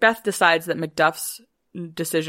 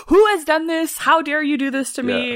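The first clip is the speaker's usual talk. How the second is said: high, loud hammed-up demand; mock outrage; 9 semitones higher, 3 dB louder than usual. Macbeth accusing the room, loud mock outrage. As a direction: rage at the guests. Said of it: "he's like".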